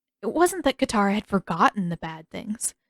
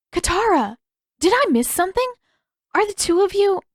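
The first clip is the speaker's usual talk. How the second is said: gasping surprise; 11 semitones higher, 5 dB louder than usual. Acted. Yue gasps at a surprise.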